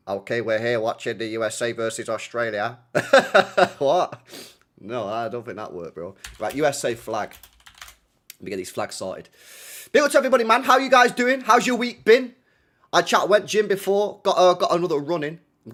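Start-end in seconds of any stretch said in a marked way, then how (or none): 2.8-3.7 burst of laughter. Real, not acted. Laughs at own attempt. 9.9-15.4 loud, high, energetic shout-out; hyped streamer greeting. Acted.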